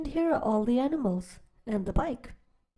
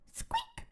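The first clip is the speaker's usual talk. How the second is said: tiny squeak, much higher and softer; mouse squeak; 11 dB quieter than usual. Acted. Reading to kids, the tiny mouse squeaks at Duck. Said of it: "said Mouse".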